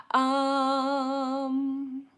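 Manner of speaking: normal talking voice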